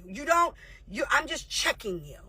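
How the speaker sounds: ordinary talk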